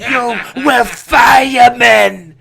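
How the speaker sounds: high slow shouting corner trainer; Teddy Atlas shout. Retold or imitated. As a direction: bellow to fire up the fighter. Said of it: "my best impression of a boxing trainer is probably Teddy".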